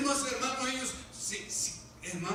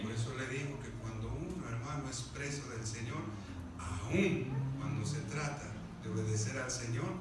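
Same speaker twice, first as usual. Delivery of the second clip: ordinary talk, nothing marked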